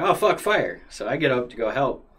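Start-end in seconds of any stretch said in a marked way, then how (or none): 0.0-0.8 sudden higher, louder "oh fuck, fire"; alarmed blurt; startled realization. Retold or imitated. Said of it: "my same thought is like".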